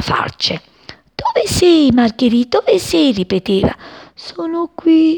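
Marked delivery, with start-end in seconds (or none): 1.1-3.1 high, loud urgent calling; frantic searching call. A narrator's reading. Sparrow digs through cut grass for daisy, calling urgently. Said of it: "con furiosi colpi di becco". 4.1-5.2 high, slow faint-flower voice; sighing flower. A narrator's reading. Buried flower answers the searching sparrow, high slow sigh. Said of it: "sospirò il fiore".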